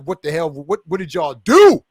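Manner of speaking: loud, slowed-down exasperated demand; angry outburst. Retold or imitated. Son confronting his father in Wakanda, loud outburst. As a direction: blast it at the ancestors, fed up. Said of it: "Sorry, I'm getting a little amped".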